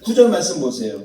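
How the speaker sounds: normal talking voice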